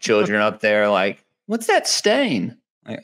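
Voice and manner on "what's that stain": much higher, drawn-out questioning voice; mock puzzled child. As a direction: play a curious kid, high and slow. Voices puzzled children spotting blood.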